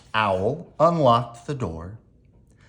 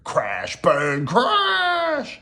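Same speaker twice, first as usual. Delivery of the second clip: high, drawn-out storm crash; dramatic crash; 10 semitones higher, much slower than usual. A narrator's reading. Storm breaks over the friends, dramatic high crash. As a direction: burst out, stretching the final word.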